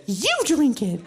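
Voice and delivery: defiant comeback. Retold or imitated. American friend pushing back at the monster, defiant. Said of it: "Sarah's American. And she has much bigger balls".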